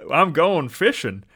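hammed-up Southern accent, loud, high and slow; mock your own Southern twang, drawn out. Shown as a demonstration. Host replaying his accidental reading in an exaggerated Southern accent. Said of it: "I don't think he had a southern accent".

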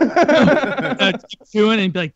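slow words through laughter; laughing while talking. Real, not acted. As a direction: giggle through the line. Laughing while telling story.

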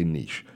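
niche said as "neesh", long ee; rhymes with leash. Shown as a demonstration. Telling listeners "I say niche", pronounced "neesh". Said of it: "but I say niche".

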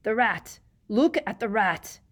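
loud, desperate cry; urgent shout. A narrator's reading. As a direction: yell it desperately.

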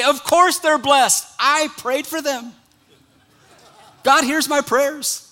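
smug confidence. Retold or imitated. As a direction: proudly claim the credit, slowly. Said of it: "then you can say".

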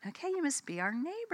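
high, soft polite greeting; retold self-corrected greeting, high and quiet; attitude held in check. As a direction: rein in the attitude and greet softly. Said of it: "just check it".

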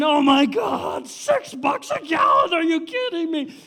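loud, high, drawn-out griping; hammed-up shout of exasperation; mock outrage. Preacher mimicking a driver upset at gas prices, mock outrage.

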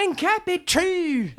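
high, drawn-out mock-Baltimore "true"; Baltimore rapper impression. Imitating a kid rapping at an audition, exaggerated Baltimore accent. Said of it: "the dude was like".

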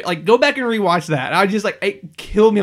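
usual speaking voice

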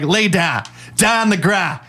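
high mock order to lie down; mock cop command. Imitating the cop ordering the suspect down. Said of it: "I love how he's like".

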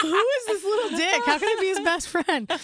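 loud, high, amazed outburst; delighted disbelief. Retold or imitated. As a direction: exclaim it, thrilled by the cheek. Amazed reaction to cheeky boy, retold.

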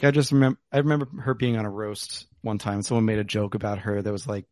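ordinary talk, nothing marked

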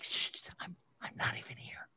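very soft mock-discreet whisper; hushed whisper. Imitating a principal sitting in on class, hushed whisper. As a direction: whisper as if trying to go unnoticed.

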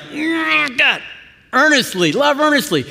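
high, strained voice like a sprinter hitting the tape; straining sprinter. Retold or imitated. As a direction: push "earnestly" out high with effort, lunging for the line. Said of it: "It's like".

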